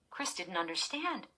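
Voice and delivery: plain reading voice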